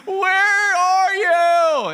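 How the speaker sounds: high, stretched-out wail of why; mock anguished cry. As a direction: draw out where are you, pleading upward. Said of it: "on your knees crying, saying".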